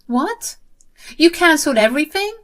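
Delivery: high, fast incredulous outburst; acted shocked sister-in-law; shocked disbelief. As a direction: gasp it out in disbelief. Jenny learns bookings are cancelled.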